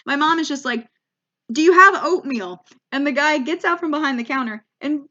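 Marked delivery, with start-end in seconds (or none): 1.5-2.7 loud, higher retold demand; imitated mom, forceful question; insistent demand. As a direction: mimic a pushy customer repeating herself loudly. Mom demands oatmeal from clerk.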